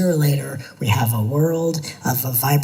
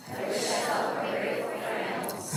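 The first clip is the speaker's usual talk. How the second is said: whispered; 11 dB quieter than usual. Shown as a demonstration. Repeated softly to self.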